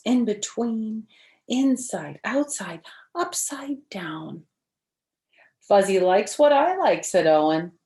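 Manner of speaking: bright, lively storybook read-aloud; reading to children. Acted. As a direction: read it like story time for little kids. Reads picture book to children.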